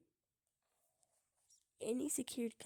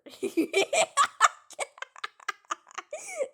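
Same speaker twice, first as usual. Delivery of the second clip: a long loud cackling laugh; loud helpless laugh; 21 dB louder than usual. Real, not acted. Gamer laughing at a bad moment. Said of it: "I can't stop laughing".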